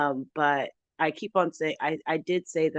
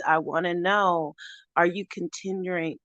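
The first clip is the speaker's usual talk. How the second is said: usual speaking voice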